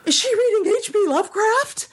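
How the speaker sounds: high, thrilled gasp of recognition; light up, half-shrieking the question; delighted surprise, voice shooting up; excited recognition. Retold or imitated. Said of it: "I saw the picture and I went".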